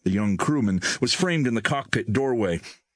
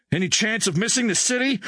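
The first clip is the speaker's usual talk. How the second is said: high-pitched hopeful question; 13 semitones higher than usual. A narrator's reading. Crewman asks about missing the city, hopeful. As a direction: lift it up, hoping for good news. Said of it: "Herzog questioned hopefully".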